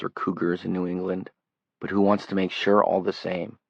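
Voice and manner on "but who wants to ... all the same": ordinary delivery, nothing marked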